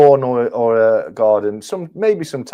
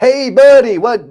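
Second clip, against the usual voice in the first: loud, high-pitched mock New York accent; New Yorker accent; 14 semitones higher, 9 dB louder than usual. Shown as a demonstration. Guest attempting an American New Yorker on request, in a loud New York accent. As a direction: call out like a hammed-up New Yorker. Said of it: "can you do an American New Yorker?".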